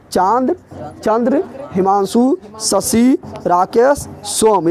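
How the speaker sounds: Chand, Chandra, Himanshu spaced out with rising list tune; word-by-word dictation. Shown as a demonstration. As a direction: dictate Chand through सोम one word at a time, pausing between. Teacher repeats list word by word.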